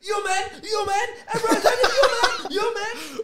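very high, loud mock yelling through laughter; imitated French streamer. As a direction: shriek it like a hammed-up excited Frenchman. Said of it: "this French guy is like".

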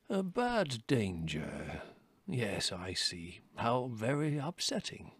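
put-on very slow, soft, drawn-out musing of an old chief; unconcerned. A narrator's reading. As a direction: draw it out softly, politely unbothered. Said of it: "looking anything but upset".

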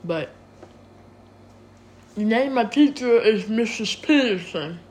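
talking around something in mouth; muffled words, mouth full. Real, not acted. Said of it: "I have a poppy in my mouth".

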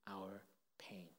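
hushed whisper on the words. Real, not acted. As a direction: breathe the words out, barely voiced. Sermon drops to a whisper.

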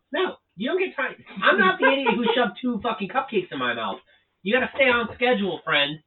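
high, loud telling-off of a friend; indignant scolding. Real, not acted. Bickering over cupcakes and schedule.

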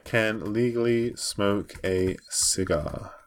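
very slow, word by word; slow typing read. Real, not acted. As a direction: drawl it out as you type. Typing it out slowly.

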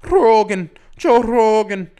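put-on high, loud Irish-accented "Rogan, Joe Rogan". Still mimicking Conor calling out Joe Rogan, loud Irish accent. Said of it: "That was my best Irish accent right there".

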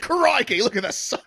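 high, loud cartoon Australian accent; mock Australian accent. Host teasing his cohost's accent, in a high, mock Australian accent. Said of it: "Was that Australian".

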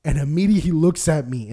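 normal talking voice

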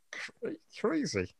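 whispered aside. Real, not acted. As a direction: breathe it out as a whisper.

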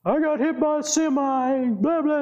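high-pitched mock wail; imitated crying. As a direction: imitate a crying daughter on the phone. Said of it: "and she's crying".

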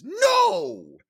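shrieking high coach outburst; Coach Greg voice. Retold or imitated. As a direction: bark a piercing "No!" way up high. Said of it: "imagine saying is".

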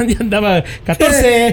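high-pitched, laughing while telling; slow words breaking into real laughter; risa al contar. Real, not acted. Laughing at rookie's muddled radio codes. (contándolo entre risas)

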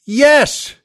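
a short, loud barked yes. Retold or imitated. Patient barking his answer at the doctor, loud and curt. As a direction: bark the answer out, fed up. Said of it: "you kind of bark it out".

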